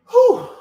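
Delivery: a big high release of breath after the effort; relieved whew. Real, not acted. Host exhales hard after a long emotional session, relieved whew. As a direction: blow it all out, relieved it's over.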